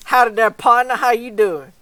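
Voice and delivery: loud, high cowboy greeting; cowboy drawl. Pretend. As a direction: holler it in a mock cowboy drawl. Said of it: "Give me that cowboy drawl".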